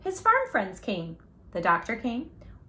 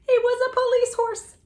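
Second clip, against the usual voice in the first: high, louder, laughing with joy; delighted reveal; 14 semitones higher, 4 dB louder than usual. A narrator's reading. Storyteller delighted for the horse, laughing. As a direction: burst into happy laughter.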